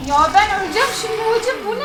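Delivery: high loud frightened cry; panicked. Real, not acted. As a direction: panic about dying. In danger.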